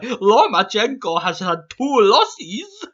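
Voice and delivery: mock the troll like a smug boy genius; troll as Dexter. Vlogger reading troll comments in a high mocking cartoon voice. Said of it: "how I imagine them in my mind".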